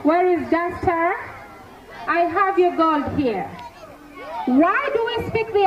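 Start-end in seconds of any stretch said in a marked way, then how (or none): none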